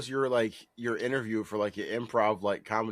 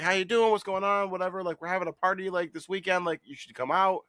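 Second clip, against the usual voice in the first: eager stranger; 9 semitones higher than usual. Retold or imitated. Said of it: "he's like".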